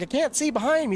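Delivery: imitating himself. Replying to Spencer on the radio, high and whiny. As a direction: answer high and put-upon. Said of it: "I'm like".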